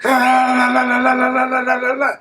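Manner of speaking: high rapid la-la-la gunfire imitation; sung-out gunshot noises; imitated gunfire. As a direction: mimic an Uzi going off with voice. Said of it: "start busting the Uzi".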